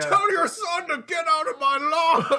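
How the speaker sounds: high, loud, drawn-out holler; hammed-up shouted complaint; mock angry yell. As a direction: imitate a neighbor yelling across the yard. Acted yell over at neighbor.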